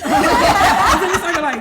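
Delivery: loud laughing burst; laughing hard while telling it; laughing through the words. Real, not acted. Laughing at her own story.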